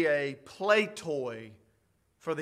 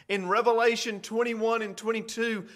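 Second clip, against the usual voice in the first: usual speaking voice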